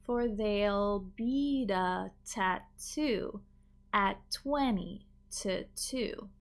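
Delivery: "For there'll be the tattoo at twenty to two" said very slowly; slow demo. Shown as a demonstration. Teacher slowing the line for learners to copy. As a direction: stretch it out word by word.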